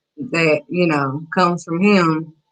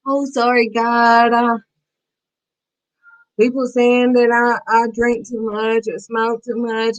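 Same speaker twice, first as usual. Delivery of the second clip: whiny mock apology, higher and dragged out; drawn-out, insincere plea for forgiveness; fake sorry; 4 semitones higher, slower than usual. Speaker imitating half-hearted repenters praying to God, fake sorry. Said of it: "with that fake sorry".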